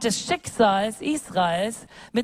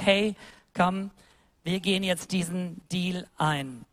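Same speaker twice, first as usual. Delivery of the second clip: gentle invitation; 5 semitones lower, 5 dB quieter than usual. Acted. God invites Abraham gently.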